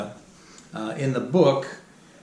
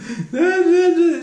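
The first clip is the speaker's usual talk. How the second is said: high laugh; 7 dB louder than usual. Real, not acted. Laughing at daughter's hysterics.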